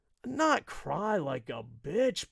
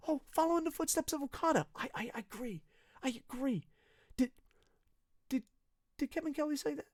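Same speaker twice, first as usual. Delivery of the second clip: softer, higher, sugary mock sweetness; mock sensitive coddling; 6 semitones higher, 3 dB quieter than usual. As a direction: coddle a fragile fan with fake sweetness. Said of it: "I'm gonna actually change my tone".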